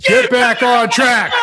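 high, loud, slow roaring shout; full shout. Shown as a demonstration. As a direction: bellow it like a stadium chant. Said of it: "You, you put me to shame on that one".

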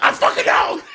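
high, loud angry yelp; exasperated outburst. Retold or imitated. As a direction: blurt it out in mock fury. Acting out Leonard's angry outburst.

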